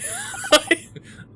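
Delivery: real high, helpless laugh; squealing burst of laughter; high-pitched laugh. Real, not acted. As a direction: crack up at your own bad accent. Laughs at own accent attempt.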